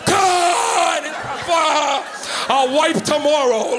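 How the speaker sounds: high, loud, dragged-out joyous shout; too happy to care; ecstatic yell. Retold or imitated. Man on the toilet celebrating, loud joyous yell. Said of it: "just hearing".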